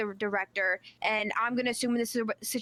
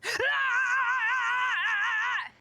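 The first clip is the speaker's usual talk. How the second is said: long, very high sustained scream; demonstrated scream. Shown as a demonstration. Player trying to out-shout the deputy, screaming. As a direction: hold one high screech without wavering. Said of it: "I have to get louder than him".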